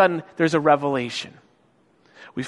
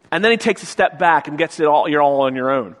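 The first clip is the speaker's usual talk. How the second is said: ordinary delivery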